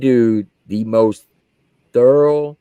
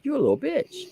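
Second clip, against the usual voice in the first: mock taunt; 14 semitones higher, 7 dB quieter than usual. Mocking the crying private.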